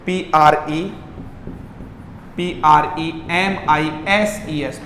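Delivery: slow letter by letter; spelling out letters. Shown as a demonstration. Teacher spelling premises slowly for students. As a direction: read each letter out slowly. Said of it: "मैं spelling लिख देता हूं आपको".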